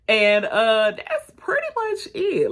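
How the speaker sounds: high, squeaky, amused voice; real giggly wrap-up; talking through laughter. Real, not acted. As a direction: let the laugh push the pitch way up. Still amused right after laughing.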